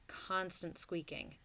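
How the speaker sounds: ordinary delivery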